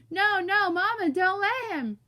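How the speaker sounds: high, loud muffled begging; panicked mumbling. A narrator's reading. Stuck boy goes nuts begging Mama, panicked muffled wail. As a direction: wail it through stuck lips. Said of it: "and mumbling".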